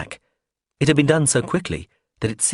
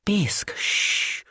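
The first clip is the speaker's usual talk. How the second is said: hushing; 11 semitones higher, 4 dB quieter, much slower than usual. Acted. Hushing her friend.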